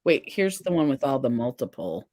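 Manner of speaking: usual speaking voice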